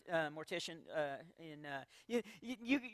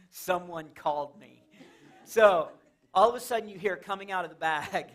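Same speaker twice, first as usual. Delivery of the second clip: ordinary talk, nothing marked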